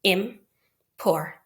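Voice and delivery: IMPORTANT broken slowly into separate syllables; slow, separated syllables of important; split into syllables. Shown as a demonstration. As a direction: say important in pieces, pausing between syllables. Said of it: "I consider three syllables".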